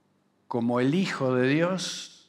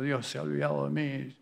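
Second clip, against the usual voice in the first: a hint of a self-pitying mumble, softer; sulky complaint; 3 semitones lower, 9 dB quieter, faster than usual. Believer complaining God forgot him, muttered softly. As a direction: grumble while kicking a stone. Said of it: "andás pateando una piedra diciendo".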